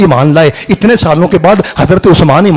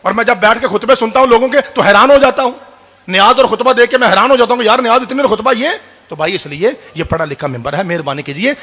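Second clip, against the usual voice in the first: ordinary talk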